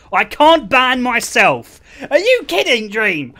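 loud, high-pitched disbelief; indignant outburst. Real, not acted. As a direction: shout it at the mod, outraged. Outraged at mod in chat.

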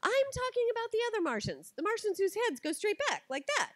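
hammed-up stubborn parent winning the debate; arguing back. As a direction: mom digging into a silly Martian argument, loud. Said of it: "I go".

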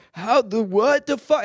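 high-pitched, incredulous outburst; baffled outrage. Retold or imitated. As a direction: imitate stunned people reacting in disbelief. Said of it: "And they go like".

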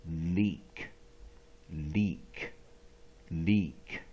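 leak said low with a long E. Shown as a demonstration. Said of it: "Long vowel E". (低沉示范 leak 的长音 E)

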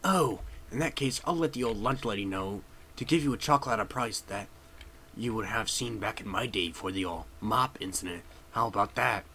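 lightly voiced old-timer, low and soft; janitor character. Janitor to kid about chocolate, lightly voiced old-timer. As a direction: play a folksy janitor offering a deal.

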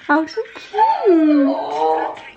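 cooing. Real, not acted. Cooing at Snapchat filter.

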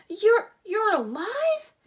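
high, loud, slow stammered disbelief; startled. A narrator's reading. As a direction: gasp it out as if seeing a ghost. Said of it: "And startled".

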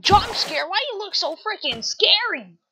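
high-pitched scared complaint; startled fright. Acted. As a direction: squeal it after a jump scare. Frightened by the other character.

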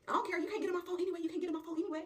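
high-pitched defiant retort. Acted. Friend quarrelling over her locked phone, defiant. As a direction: argue back with pitch well up.

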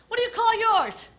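usual speaking voice

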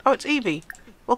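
ordinary talk, nothing marked

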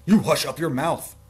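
high, very loud shout; explosive, outraged royal yell; furious howl. Acted. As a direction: howl it at the complainer. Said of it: "Howled the mighty King Yertle".

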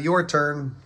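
dad voice. A narrator's reading. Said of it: "yelled SpongeBob's dad".

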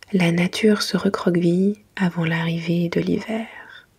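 usual speaking voice

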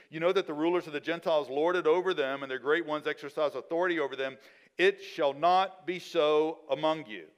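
loud, fast, then slowing on the last line; forceful preaching. Acted. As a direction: proclaim it like a preacher. Preacher reads Jesus' words forcefully.

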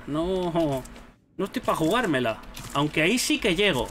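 usual speaking voice

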